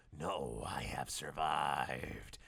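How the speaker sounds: low, slow whispered reply. Acted. Alenko telling Garfield he lived, low slow whisper. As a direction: breathe it out low and secret. Stranger voiced in reading.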